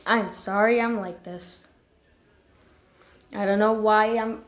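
normal talking voice

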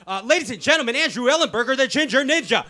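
loud high ring announcer intro; hype announcer. Acted. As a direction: announce him like a prizefighter.

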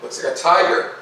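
plain reading voice

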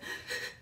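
quick breathy mock panting; imitated panicked panting. Friend panicking in the car on a ghost hunt, imitated panting. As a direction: pant like a panicking passenger. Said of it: "Please stop panting".

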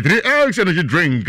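high, loud brand call; hyped ad slogan. Acted. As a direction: punch the brand name. Slogan again.

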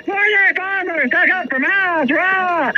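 high, far-off sneering voice of the trolls; hammed-up mock battle cry, high and sneering; mocking imitation. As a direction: lampoon their rallying cry. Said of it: "the story that you guys say".

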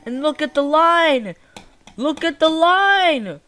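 loud, high exclamation; incredulous outcry. Real, not acted. Gamer pointing out the glitched line, incredulous outcry. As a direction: call out in disbelief, hitting the last word.

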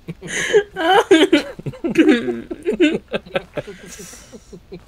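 squealing high laugh; shrieking with laughter. Real, not acted.

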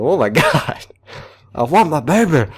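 laughing, then loud cry; half-laughing outcry; laughing shock. Real, not acted. Shocked by note, playful.

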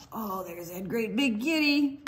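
ordinary talk

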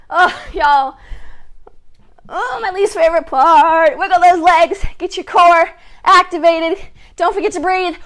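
ordinary talk, nothing marked

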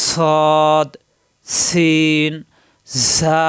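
সৎ, সিন, ঝা drawn out with a long airy hiss; whistling sibilants. Shown as a demonstration. Teacher demonstrating escaping air to learners, whistling sibilants. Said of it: "বাতাস বের হবে".

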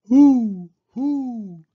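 high, drawn-out hoots; owl hoot. Acted. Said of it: "asked the owl".

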